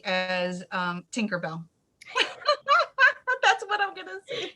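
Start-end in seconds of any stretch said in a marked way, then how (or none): 2.1-4.5 giggling through the words, high; real laughter bubbling through; laughing. Real, not acted. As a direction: laugh it out, tickled by the thought. Laughing at Tinkerbell image.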